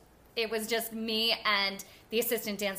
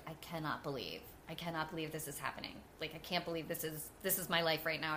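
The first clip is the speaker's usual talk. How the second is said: softer, lower, hushed disbelief; awed hush; 6 semitones lower, 9 dB quieter, faster than usual. Retold or imitated. New cast member watching the show, hushed awe. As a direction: whisper it like you're stunned by the show. Completely just wowed.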